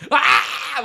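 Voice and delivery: high mimicked shriek "Ah!"; mock scream "Ah!". Grandson imitating grandma's startled scream. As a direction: squeal it like the scared grandma. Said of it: "she's like".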